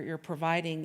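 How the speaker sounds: ordinary delivery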